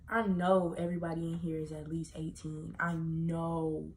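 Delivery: normal talking voice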